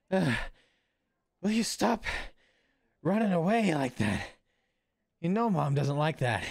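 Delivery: put-on a touch of tired teenage exasperation; exhausted older kid. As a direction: scold a younger brother, out of breath from chasing. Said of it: "like, an exhausted other kid".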